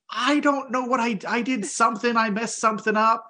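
high and rattled, words spilling out; panicked. Retold or imitated. Son telling his parents about the mistake, high and panicked. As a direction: sound like you just broke something big. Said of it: "I'm freaking out".

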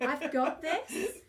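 mock confident. Fan psyching herself up to approach the drummer, slow and cocky. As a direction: drawl it out like you own the room. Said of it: "I have not got this".

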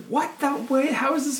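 high, incredulous disbelief; surprised. Retold or imitated. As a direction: ask it in shocked disbelief. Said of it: "And I was like".

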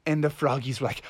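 usual speaking voice

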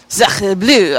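playful mock French accent. Player reading rules in character, mock French accent. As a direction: exclaim in a put-on French accent. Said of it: "use a French accent".

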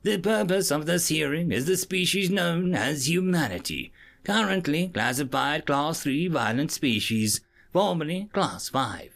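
high-pitched judge. Acted. Maisto judge introducing the case, high and formal.

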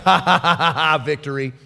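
acted laugh sinking into low victory; mock-up of a victor's chuckle; triumphant laugh. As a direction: laugh in the devil's face, then drop low. Jesus imagined laughing while being whipped.

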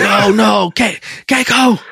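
high-pitched distressed cry for a missing wife; mock panic. Host imitates a husband fearing his wife is lost, mock panic.

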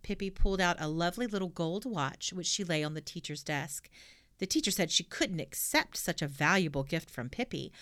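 usual speaking voice

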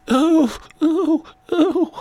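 very high-pitched "ooh"; comic little falsetto oohs of pain; mock spanking yelps. Acts out being spanked by God.

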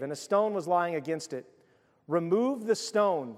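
ordinary talk

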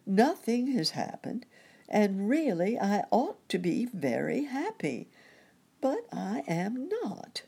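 high-pitched, emphatic complaint; exasperated protest. A narrator's reading. Rabbit with the blues answering his nurse, exasperated. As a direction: insist on it, pitched well up. Wiggily insisting he is unhappy.

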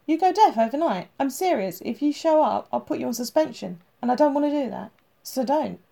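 no arguments, this is final; stern order. A narrator's reading. Captain orders Tim to stay home.

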